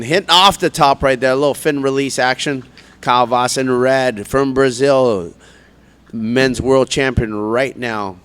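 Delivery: loud live play-by-play; laid-back surfer announcer. Real, not acted. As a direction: call the ride as it happens.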